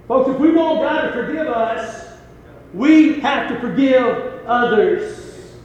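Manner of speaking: high, slowing, weighty appeal; emphatic preaching. Real, not acted. Preacher urging folks to forgive others, emphatic preaching. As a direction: drive the point home, stretching the last phrase.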